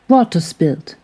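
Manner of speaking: ordinary delivery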